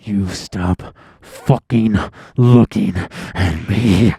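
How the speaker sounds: slow, loud, hammed-up threat; mock menacing outburst. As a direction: punch each word out slowly and loudly. Plays along as aggressive maniac.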